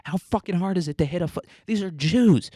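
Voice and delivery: high-pitched exasperated mock yelling; yelling handler. CIA handler berating Mohammed for botching the attack, mock exasperated yelling. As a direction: act out an incredulous, fed-up handler chewing someone out. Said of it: "his handler's yelling at him".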